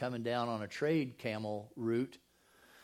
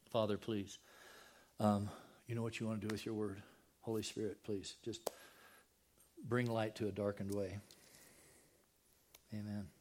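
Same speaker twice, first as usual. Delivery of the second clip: soft, lower prayer voice; quiet prayer; 3 semitones lower, 6 dB quieter than usual. Acted. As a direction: pray humbly before the reading. Opening prayer.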